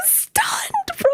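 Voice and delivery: slow squeal climbing very high; rising squeal of disbelief; stunned squeal. Real, not acted. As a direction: stretch it into a high, overwhelmed squeak. Exclaiming how stunned they were.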